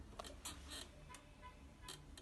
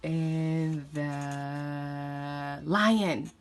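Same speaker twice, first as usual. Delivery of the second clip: low slow voice; 5 semitones lower, much slower than usual. Acted. Mom slows down for the lion.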